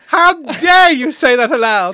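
loud, high, drawn-out indignation; hammed-up diva offence; mock outrage. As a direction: play scandalised, stretching it out at full volume. Mock outrage at co-host's admission.

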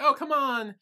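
mock coaxing, starting high and falling; eager coaxing. She pushes him to join the dancing, eager coaxing. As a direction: imitate someone cajoling a reluctant friend.

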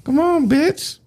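high taunting hag voice; witch voice. Acted. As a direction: jeer like a cackling hermit. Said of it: "what voice is that?".

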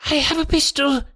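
very high, thin quavering threat; reedy, quivering voice. A narrator's reading. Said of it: "came a reedy, quivering voice".

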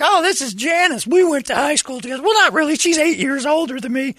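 high, put-on voice mimicking chatty townsfolk; chatty small-town local. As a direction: squeal the introductions like an overfriendly local. Retold Columbus introductions.

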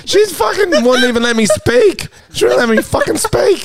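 high-pitched outraged rant; worked-up, squealing indignation; indignant. Real, not acted. Outraged after prank caller insults him.

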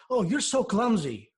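a hint of a mom's reproach; scolding mom. Mom to child over a broken glass, lightly acted scolding. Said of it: "your mom says".

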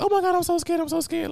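squeaky high mock fright; mock scared. Mocking the lady who claimed she's scared, squeaky high. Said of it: "She was like".

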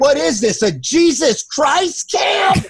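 high, slow, incredulous mock shout; mocking outburst. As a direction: drag it out scornfully at the whole campfire. Mocking the campfire Jesus talk.

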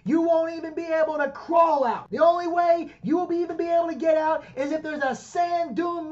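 bury him with your voice, over the top; hammed-up mafia rage. Hammed-up mafia threat to friend.

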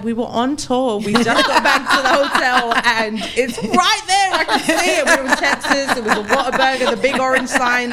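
high, loud, laughing insistence; insistent pleading. Retold or imitated. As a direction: re-enact an urgent craving plea, pitch climbing. Retelling her begging at night.

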